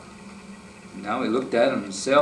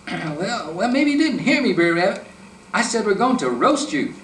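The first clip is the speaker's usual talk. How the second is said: much higher and louder, insisting; exasperated fox; 9 semitones higher, 4 dB louder than usual. A narrator's reading. Fox to the laughing rabbit, a loud exasperated threat. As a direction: raise the voice, he didn't hear you.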